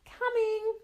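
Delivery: high, loud answer back; child yelling she's on her way; shouted reply. Acted. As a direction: call it down to mum. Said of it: "Mona shouted back".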